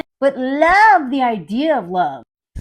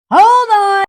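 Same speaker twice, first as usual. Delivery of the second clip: loud, high cutting-in; mock alarm; 7 semitones higher, 8 dB louder than usual. Woman suddenly doubting the man, loud mock alarm. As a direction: halt him with a raised voice. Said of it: "And then she's like".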